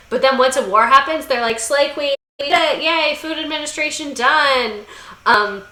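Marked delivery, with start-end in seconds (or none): 1.6-4.8 high, peppy celebration; hammed-up yay, pitched high; mock cheering. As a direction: cheer it in a raised, gleeful voice. Government acted cheering once war happens.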